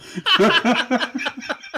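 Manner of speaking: loud high-pitched laugh. Real, not acted. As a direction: burst out laughing. Reading silly dating article.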